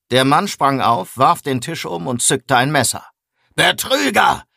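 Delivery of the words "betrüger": wütender Ausruf. A narrator's reading. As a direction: cry it out as an accusation, pitch jumping up. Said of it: "schrie er mich an". (wütend angeschrien, mit hochschnellender Stimme)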